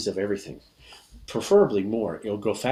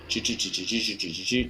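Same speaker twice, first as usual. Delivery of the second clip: rapid chain of choo-choos; imitated train chug. Shown as a demonstration. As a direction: chug along like a steam train. Train sound for the train card.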